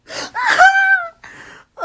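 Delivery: loud, very high burst of glee; delighted squeal. Real, not acted. Fan melting over the cupcake scenario, delighted squeal. Reacting to sweet character.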